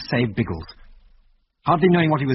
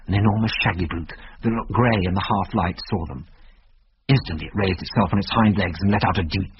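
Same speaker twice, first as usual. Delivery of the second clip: ordinary delivery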